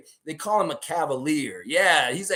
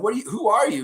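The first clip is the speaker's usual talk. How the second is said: startled question; 8 semitones higher, 5 dB louder, faster than usual. Retold or imitated. Confused raptor questions driver.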